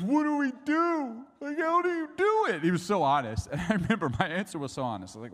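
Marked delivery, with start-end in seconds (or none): 0.0-2.6 high, loud mock-crying plea; hammed-up sobbing child's voice; tearful son. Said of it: "And he starts crying".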